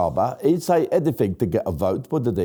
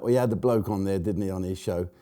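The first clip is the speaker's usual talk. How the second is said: normal talking voice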